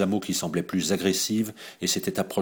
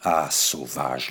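distraught; 5 semitones lower, much slower than usual. A narrator's reading. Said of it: "lui jeta Raoul éperdu".

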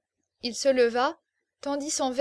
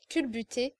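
ordinary delivery

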